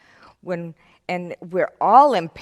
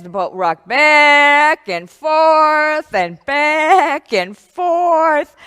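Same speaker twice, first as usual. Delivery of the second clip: dramatic storytelling; 6 semitones higher, 9 dB louder, much slower than usual. A narrator's reading. Storm rocks the boat.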